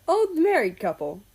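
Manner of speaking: laughing out the words, loud and high. A narrator's reading. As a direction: crack up while repeating the phrase. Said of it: "America laughed".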